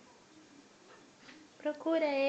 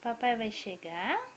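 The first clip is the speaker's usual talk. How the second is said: normal talking voice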